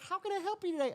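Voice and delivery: high-pitched, chirpy server greeting; eager server. Retold or imitated. Acting the friendly fast-food server.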